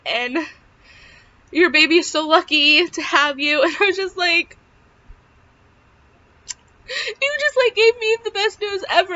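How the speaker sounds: high, emotional voice near happy tears; welling up, pitch staying high, with a sigh; overcome with relief. Real, not acted. Overcome retelling her relief.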